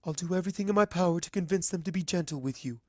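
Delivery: a hint of a rushed, sorrowful queen; hurried promise. Celestia to Twilight, quick earnest promise.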